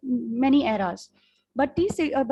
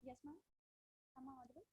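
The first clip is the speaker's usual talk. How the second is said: faint, distant voice on a call; speaking far from the microphone; barely audible; 34 dB quieter, much faster than usual. Real, not acted. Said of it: "It's very faint".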